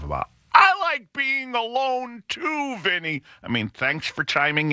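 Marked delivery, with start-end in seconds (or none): 0.5-3.2 slow, higher, sing-song mimic of a preachy commenter; mock commenter. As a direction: parody a smug stranger online. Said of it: "who decided to write a huge paragraph".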